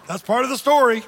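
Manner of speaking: usual speaking voice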